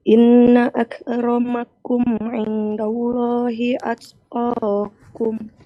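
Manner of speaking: usual speaking voice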